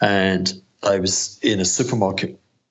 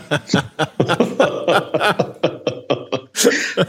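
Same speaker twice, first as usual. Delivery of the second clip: long, high burst of laughter; hearty laugh; 10 semitones higher than usual. Real, not acted. Guest joking about avoiding comments, hearty laugh. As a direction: crack up at your own joke. Laughing at his own joke.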